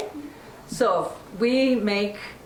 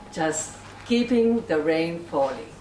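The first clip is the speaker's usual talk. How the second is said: usual speaking voice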